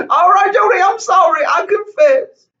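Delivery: high pleading plea to Jody; mock pleading. Tom begging his wife Jody to forgive him.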